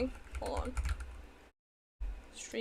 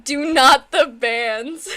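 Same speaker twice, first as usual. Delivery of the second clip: laughing; 9 dB louder, slower than usual. Real, not acted. Laughing plea.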